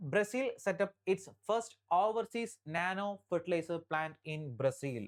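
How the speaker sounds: very slow, word by word headline read; slow dictation. Shown as a demonstration. Teacher dictating headline to exam students, very slow. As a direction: dictate it slowly so students can write. Reads headline slowly for notes.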